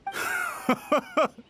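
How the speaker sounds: a sharp, high burst of mocking laughter; a derisive cackle of disbelief; scornful laugh. Mocking the commissioner's pity.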